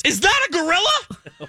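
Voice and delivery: very high mock-panicked shriek; losing her mind. Frightened woman sees a gorilla from the car, hysterical. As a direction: imitate a hysterical freak-out. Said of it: "she's losing her mind in the back seat".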